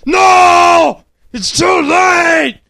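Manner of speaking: high, strained full-volume yell; wounded father. A narrator's reading. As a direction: bellow slowly as a hammed-up dying father. Dying father answers son.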